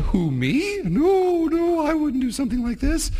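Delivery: high, feigned-innocent denial; mock-innocent devil denying it all; innocent Satan. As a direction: play Satan acting shocked at the idea. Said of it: "And Satan going".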